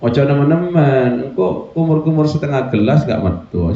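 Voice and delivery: normal talking voice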